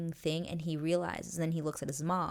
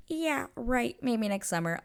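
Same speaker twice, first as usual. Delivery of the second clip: higher, scoffing dismissal; sarcastic brush-off; 7 semitones higher, 3 dB louder than usual. Retold or imitated. Kate brushing off Anthony's advances, higher and scoffing. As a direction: toss it off with a scoff. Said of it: "Kate's like".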